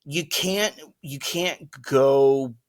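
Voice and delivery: normal talking voice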